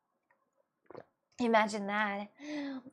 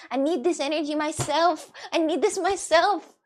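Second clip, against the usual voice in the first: loud, higher, emphatic demand; pissed-off insistence; 5 semitones higher, 6 dB louder than usual. Real, not acted. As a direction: build in frustration on each repeat. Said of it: "I was just pissed for a second".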